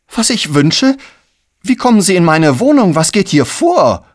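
loud, high, outraged questioning; indignant. Acted. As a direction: demand an explanation from the intruder. Empörter Besitzer stellt den Fremden. (empört und laut zur Rede stellen)

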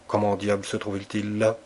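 plain reading voice

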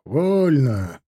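short parade-ground order; military command. A narrator's reading. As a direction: release the ranks with a called order. Officer's command to the ranks.

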